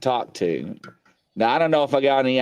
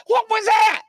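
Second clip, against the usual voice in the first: high, loud mock alarm; startled retold shout; 5 dB louder than usual. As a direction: imitate a shocked caller, very high and loud. Retelling startled caller on video chat.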